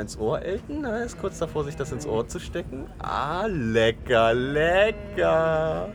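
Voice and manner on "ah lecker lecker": long drawn-out, rising mock delight; mock relish. Teasing Elton about the shared earpiece, mock relish. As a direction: savour each word very slowly, hammed-up.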